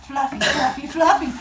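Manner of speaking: high, light sing-out chant; squirrel cheerleaders. Acted. Squirrel cheerleaders fluff their tails for rabbit, cutesy chant. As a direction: chirp it as a silly high cheer.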